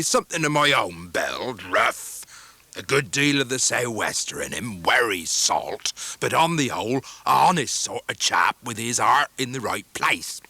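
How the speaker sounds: slow rough fisherman voice; a homely old sailor, deeply moved; Mr Peggotty. A narrator's reading. Mr Peggotty welcoming guests by fire, rough sailor voice.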